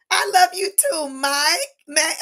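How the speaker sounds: high, loud gush of affection; real happy gush; excited thanks. Real, not acted. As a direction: beam it back to him. Responding to a viewer's love.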